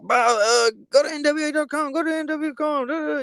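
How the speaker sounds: very high, loud parody ad voice; mock commercial pitchman. Cohost mocking merch commercials, high pitchman voice. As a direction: rattle off a silly high-pitched plug. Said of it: "commercials where he's like".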